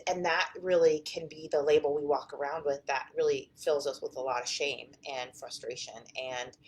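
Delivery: ordinary talk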